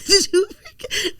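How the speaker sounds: high-pitched laughter breaking through the words; can't get the words out for laughing; real giggling fit; laughing hard. Real, not acted. Cracking up telling the story.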